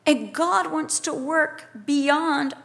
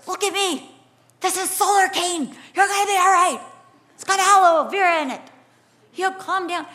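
raised, insistent mother's voice; urgent parent; 4 semitones higher, 5 dB louder than usual. Retold or imitated. Mother to daughter panicking over sunburn, urgent and insistent. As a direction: take charge of a panicking child, higher and forceful. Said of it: "I remember just grabbing her going".

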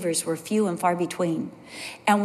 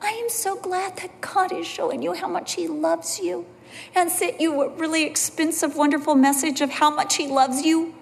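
sincere praise; 7 semitones higher than usual. Retold or imitated. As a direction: bless your friend gently. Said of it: "I was like".

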